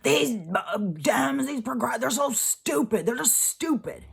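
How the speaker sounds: mocking people. Mocking critics who call progressives stupid, sneering. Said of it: "sick of hearing people say".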